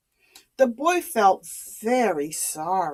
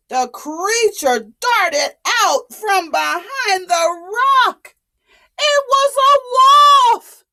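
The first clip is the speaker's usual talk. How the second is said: higher, loud cry of alarm; dramatic alarm; 8 semitones higher, 4 dB louder than usual. A narrator's reading. Storyteller crying out as the wolf appears, loud. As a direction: announce the danger breathlessly.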